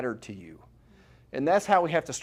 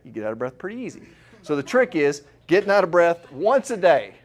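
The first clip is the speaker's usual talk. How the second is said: ordinary delivery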